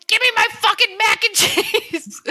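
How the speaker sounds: high, laughing pretend demand; half-laughing, cartoonish tough-guy demand; mock demand. Imagined demand at tent camp.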